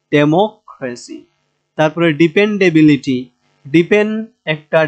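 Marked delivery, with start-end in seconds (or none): 0.1-1.2 slow democracy with a weighty মো; democracy broken after a stressed মো, falling at the end. Shown as a demonstration. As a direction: repeat democracy slowly, leaning on মো. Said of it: "এই তিনে এসে এখানে জোর হবে".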